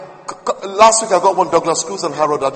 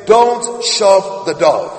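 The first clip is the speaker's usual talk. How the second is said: don't shove the dog, drawn out and louder; slow emphatic; 7 dB louder, much slower than usual. Acted. Preacher drives his point home to congregation, slow and loud. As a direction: lean on each word slowly.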